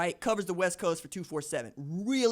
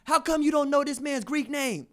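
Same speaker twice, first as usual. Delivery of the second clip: mock indignant caller, pitched up; raised-voice retold complaint; blessing him out; 8 semitones higher, 4 dB louder than usual. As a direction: imitate a caller telling someone off. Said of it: "Dude called in a radio station to bless him out".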